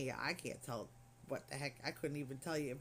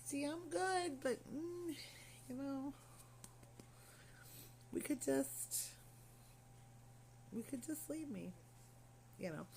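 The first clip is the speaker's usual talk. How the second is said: tiny high-pitched hesitant voice; meek pleading; 9 semitones higher, 7 dB quieter, slower than usual. Retold or imitated. Patient asks staff to just leave her, meek pleading. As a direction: plead timidly, with long hesitant gaps. Timidly pleading to be left alone.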